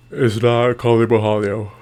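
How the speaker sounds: words stretched by a yawn. Real, not acted. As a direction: talk through a yawn. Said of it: "Sorry, I'm yawning".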